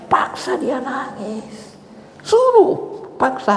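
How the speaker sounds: very high, insistent urging; forceful command. Acted. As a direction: press the order home in a high voice. (menyuruh dengan nada tinggi dan mendesak)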